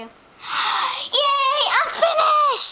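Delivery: high laughing cheer; excited shout. Real, not acted. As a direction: cry out with joy. Kid cries out, laughing.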